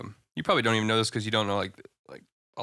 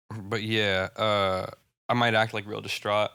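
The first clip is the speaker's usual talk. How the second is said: ordinary talk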